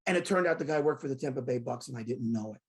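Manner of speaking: ordinary talk, nothing marked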